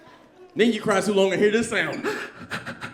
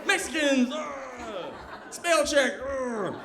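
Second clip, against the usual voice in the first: president rant; 5 semitones higher, 5 dB quieter, much slower than usual. Retold or imitated. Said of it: "He was like".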